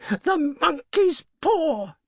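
very high, drawn-out exclamation; wild cry. A narrator's reading. Wife waking husband with a sudden idea, crying wildly. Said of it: "she cried wildly".

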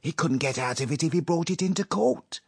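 conspiring with his wife, quivering with impatience; impatient. A narrator's reading. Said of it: "quivering with impatience".

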